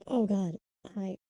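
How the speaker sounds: usual speaking voice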